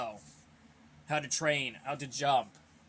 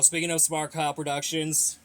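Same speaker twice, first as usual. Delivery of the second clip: usual speaking voice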